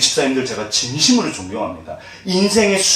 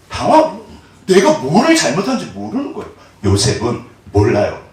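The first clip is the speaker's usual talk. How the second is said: ordinary delivery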